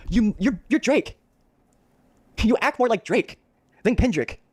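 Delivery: high, taunting voice; mocking high insult. Real, not acted. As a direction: jeer it up high.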